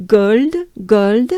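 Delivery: "Gold" projected in a French accent. Shown as a demonstration. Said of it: "gold with a French accent".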